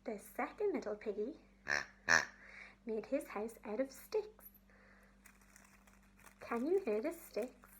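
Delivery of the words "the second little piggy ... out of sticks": usual speaking voice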